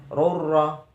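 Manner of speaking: "rurra" merged into a rolled double r, no hum; idgham bila ghunnah. Shown as a demonstration. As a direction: roll straight through the r in "rurra" without nasality. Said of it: "idgham bila gunah". (baca "rurra" melebur tanpa dengung)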